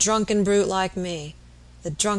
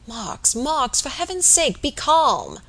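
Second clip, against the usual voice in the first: higher, urgent earnest plea; 7 semitones higher, slower than usual. A narrator's reading. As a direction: beg him to calm down. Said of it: "said Robert earnestly".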